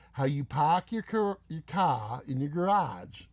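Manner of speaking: drawn-out Boston accent with dropped R's. Shown as a demonstration. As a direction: tease with a slow Boston accent. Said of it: "how Boston you are".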